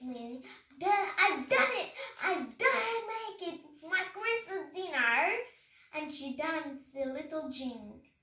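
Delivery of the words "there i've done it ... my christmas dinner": high, loud crowing; triumphant. A narrator's reading. Minnie crowing over her successful spell. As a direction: crow with delight.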